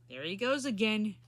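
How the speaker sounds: plain reading voice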